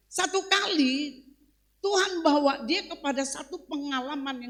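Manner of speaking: loud, high-pitched sermon exhortation; ringing, forceful preacher's proclamation; full-force preaching. Real, not acted. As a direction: drive it home to the congregation. Preaches at raised pitch and volume.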